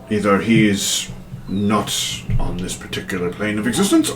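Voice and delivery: lightly voiced scholar, slow and halting; pondering scholar. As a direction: draw out the words while theorising. Plays Penrith theorising about the mask.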